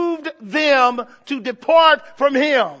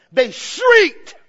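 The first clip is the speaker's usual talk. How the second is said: high, loud burst on shrieked; 6 semitones higher, 6 dB louder than usual. Shown as a demonstration. Preacher showing how the demons cried out, shrieking. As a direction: let the word shriek. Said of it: "just like this".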